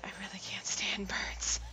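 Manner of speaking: slow whispered confession; whispered aside. Real, not acted. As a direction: murmur it under your breath. Player aside while reading.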